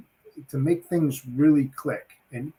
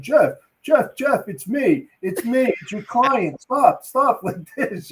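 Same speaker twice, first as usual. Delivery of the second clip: laughing client protest; 9 semitones higher, 4 dB louder, slower than usual. Retold or imitated. Client interrupting mistaken call.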